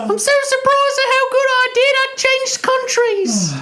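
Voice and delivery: very high hammy British squeal; British accent. Acted. Contestant surprised at how good it went, squealing British accent. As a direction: gush in a squeaky mock British voice. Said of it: "I'm British all of a sudden".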